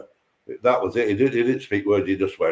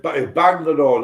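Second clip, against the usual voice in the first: ordinary talk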